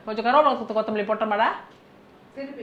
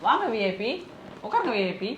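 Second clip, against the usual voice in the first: mock-polite VIP welcome; teasing sarcasm; 3 semitones higher, 6 dB quieter, faster than usual. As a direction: needle the guest with understated courtesy. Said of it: "ரொம்ப நக்கல் தான் உனக்கு". (நக்கலாக உபசரிப்பது)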